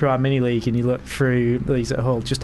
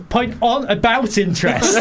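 high excited shout; shouted pun; 9 semitones higher, 5 dB louder than usual. Real, not acted. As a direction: yell it like a cheer.

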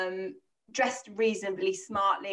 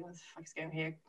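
much softer, under the breath; muttered thought; 3 semitones lower, 13 dB quieter, faster than usual. Retold or imitated. Scene: alone on a strange street, muttering to herself quietly. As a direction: mutter it to yourself, alarmed.

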